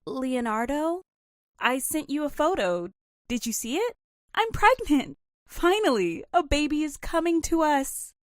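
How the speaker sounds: excited wife sharing the news; joyful announcement. Acted. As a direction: tell him you're pregnant, nearly in tears of joy.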